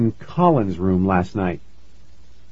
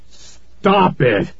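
higher, exasperated dad cry; angry dad's order; 6 semitones higher than usual. A narrator's reading. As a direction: cut him off with a sharp command. Dad pounds table, ordering son.

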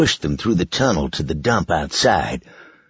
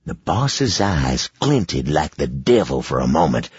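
ordinary delivery

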